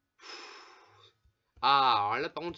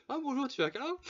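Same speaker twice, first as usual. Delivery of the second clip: much higher, sing-song creepy offer; creepy sweet stranger; 12 semitones higher, faster than usual. Shown as a demonstration. Player imagining a psychopath offering a hug, high creepy voice. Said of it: "Imagine la personne".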